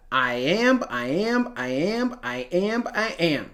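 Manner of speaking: each "iamb" said softly, beat on the "amb"; rhythmic counting. Shown as a demonstration. Teacher softly counting the feet of a line, rhythmic. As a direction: count out "iamb" like a steady pulse, quietly. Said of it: "you could look at it as".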